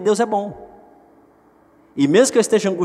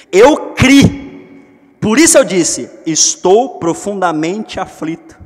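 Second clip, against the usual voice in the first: psalm proclamation; 4 semitones higher, 7 dB louder, slower than usual. Acted.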